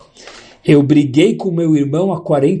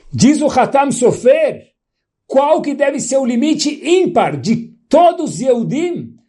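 fervent preaching; 5 semitones higher than usual. Acted. Rabbi to congregation on keeping peace, fervent preaching. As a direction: lift it up and preach, drawing it out. Rising teaching on peace and limits.

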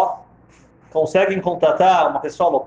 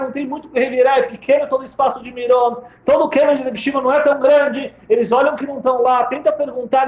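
usual speaking voice

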